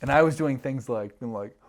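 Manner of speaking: ordinary delivery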